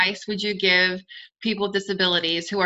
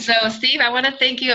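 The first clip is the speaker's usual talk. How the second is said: coughing; 5 semitones higher, 4 dB louder than usual. Real, not acted. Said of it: "I'm sorry I'm coughing".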